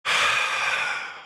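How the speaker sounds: a long breathy sigh; weary exhale before answering; heavy sigh. Real, not acted. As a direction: let out a tired sigh. Sigh after tease.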